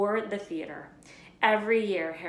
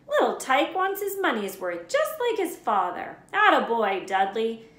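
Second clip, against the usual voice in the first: proud father; 5 semitones higher, 9 dB louder than usual. A narrator's reading. Vernon proudly praising son.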